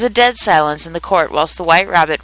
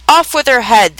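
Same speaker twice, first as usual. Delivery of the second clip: raised, louder royal order; shouted command; 5 semitones higher, 5 dB louder than usual. A narrator's reading. The queen orders Alice's beheading, shouting. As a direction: call it out high and loud, as narrated shouting. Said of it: "shouted at the top of her voice".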